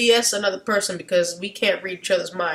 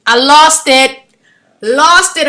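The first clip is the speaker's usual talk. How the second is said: louder, higher burst of excitement; excited; 5 semitones higher, 12 dB louder, slower than usual. Real, not acted. Recalling laughing at the Bible story, loud and excited. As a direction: exclaim it, still amused.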